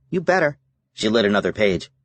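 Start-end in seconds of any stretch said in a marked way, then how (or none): 0.1-0.6 put-on young girl voice. A narrator's reading. Sydney playfully warning Mitch.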